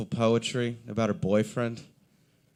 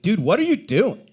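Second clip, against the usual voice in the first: impatient customers; 8 semitones higher, 6 dB louder than usual. Retold or imitated.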